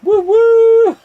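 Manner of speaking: high, loud celebratory cheer; excited whoop. Real, not acted. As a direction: whoop it up for the winner. Host cheers the champion.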